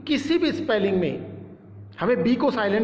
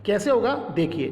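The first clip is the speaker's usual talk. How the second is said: ordinary talk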